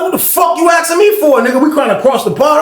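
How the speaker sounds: high indignant yell; retold angry yell. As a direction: shout it back at him, fed up. Yelling back at a cellmate.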